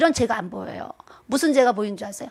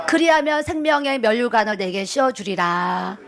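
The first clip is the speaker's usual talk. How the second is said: slow, solemn, lightly lowered divine voice; voice of the Lord; 4 semitones lower than usual. Retold or imitated. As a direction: speak it like Jesus calling, gently and weighty. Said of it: "주님이 저한테 뭐라고 하셨는지". (주님의 엄숙한 음성을 흉내 내듯)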